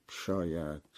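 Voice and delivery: a faint last شاید; fading to almost nothing. A narrator's reading. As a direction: let شاید die away into silence. Narrator trailing off in doubt.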